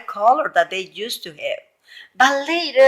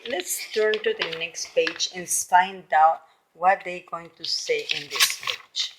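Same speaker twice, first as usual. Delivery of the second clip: usual speaking voice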